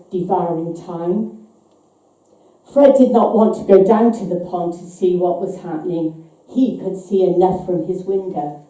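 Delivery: ordinary delivery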